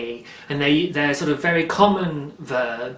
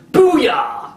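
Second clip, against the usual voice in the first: high, loud booyah of victory; triumphant cheer; 12 semitones higher, 7 dB louder than usual. Real, not acted. Celebrating passing her test with a triumphant shout. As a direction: shout it out like you just won.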